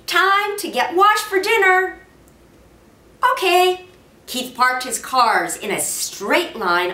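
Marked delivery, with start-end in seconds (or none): 0.0-2.0 raised, high call. A narrator's reading. Mother calling Keith to wash for dinner, loud. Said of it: "his mother called from the next room". 3.3-3.9 high raised answer; calling back. A narrator's reading. Boy answering his mother through the wall, raised.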